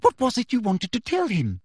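high, eager questioning; excited. A narrator's reading. Teacher presses girl for the secret, excited. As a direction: press eagerly, pitched well up. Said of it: "sudden resurgence of excitement".

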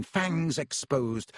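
ordinary delivery

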